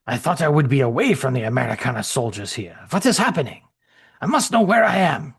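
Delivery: hammed-up soldier, confused and pressing; German soldier voice. Shrek explaining his arrival to Gerstein, uneasy accented voice.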